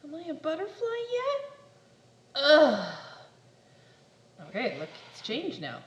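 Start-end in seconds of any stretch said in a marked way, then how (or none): 0.0-1.6 much higher, hopeful question; high childlike pestering question; eager caterpillar voice. Acted. Impatient caterpillar asks. 2.4-2.9 frustrated ugh. Acted. Frustrated groan.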